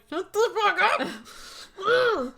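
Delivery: high, slow, laughing curse; laughing mock snap. [name] telling Rich off, high, slow and laughing. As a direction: drag it out through laughter.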